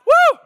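high, loud joyful whoop; ecstatic woo. Real, not acted. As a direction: let out a whoop of joy. Ecstatic shout in sermon.

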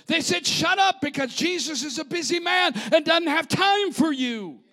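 high, loud, emphatic preacher voice; fired-up preaching. Real, not acted. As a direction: preach it hard, hammering each rebuke. Preaches at full force.

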